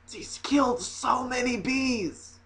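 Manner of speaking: slow, high and hushed; drawn-out, softly appalled lament; mock horror. Comic lamenting the bees the woman killed, mock horror.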